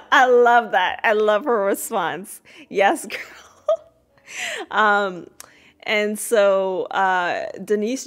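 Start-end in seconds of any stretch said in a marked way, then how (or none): 2.7-4.7 laughing cheer. Real, not acted. Host cheers Sandra's answer.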